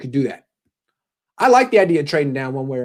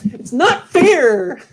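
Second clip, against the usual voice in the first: high, long-drawn mock whine; Kyle Shanahan; 10 semitones higher, 4 dB louder, much slower than usual. Host mocks coach feeling cheated, slow high whine. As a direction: stretch it into a high childish wail. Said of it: "Stop whining, Kyle".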